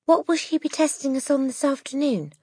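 put-on high-pitched small girl's voice. A narrator's reading. Small girl asking about the afternoon's test, high child voice. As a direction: pitch it up high, like a little schoolgirl. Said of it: "a small girl asked".

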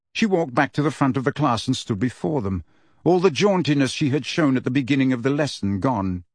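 ordinary delivery

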